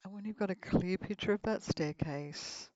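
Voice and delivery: guided meditation. Acted. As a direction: lead them gently into relaxation.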